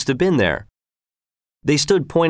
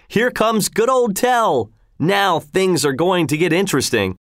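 excited crowd call; 8 semitones higher than usual. A narrator's reading. Crowd welcoming Tell to the field, excited. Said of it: "the crowd shouted".

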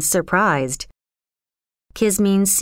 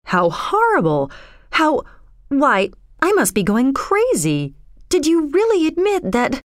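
aghast, pitch climbing through the questions; horrified young man, faltering then rising; appalled; 8 semitones higher than usual. Acted. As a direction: stammer in mild disbelief. John appalled at her confession.

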